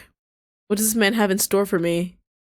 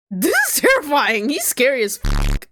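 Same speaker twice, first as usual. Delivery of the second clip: high-pitched, alarmed squeal of words; freaked-out reaction; 7 semitones higher, 4 dB louder than usual. Real, not acted. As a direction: pitch jumps up in dismay. Freaked out by the game character.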